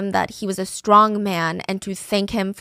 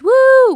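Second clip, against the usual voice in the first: loud, high mock cheer; drawn-out high "woo"; 10 dB louder than usual. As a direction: imitate passers-by whooping them on. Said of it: "would literally cheer them on".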